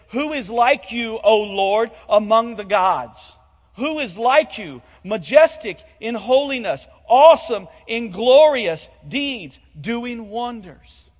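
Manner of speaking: loud, proclaiming the psalm; declaimed scripture quote. Acted. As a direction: ring it out like a hymn of praise. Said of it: "he says".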